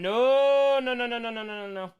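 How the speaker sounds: long loud high "no" tumbling into rapid no-no-no; mock protest. Joking about another cameraman, mock protest. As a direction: wail the first no, then rattle off the rest. Drawn-out refusal to cameraman bit.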